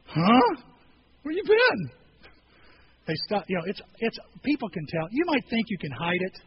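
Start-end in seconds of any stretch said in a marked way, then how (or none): 0.1-1.9 higher, louder disbelief; as if he must be kidding; incredulous. Retold or imitated. Disciples answering Jesus, loud incredulous disbelief. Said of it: "Haven't you heard?".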